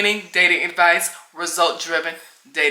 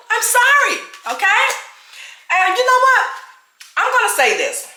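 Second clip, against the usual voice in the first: defiant; 14 semitones higher than usual. Real, not acted. Defiant about her loudness.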